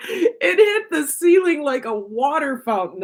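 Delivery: high, laughing through the words. Real, not acted. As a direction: giggle while telling it. Laughs about the explosion.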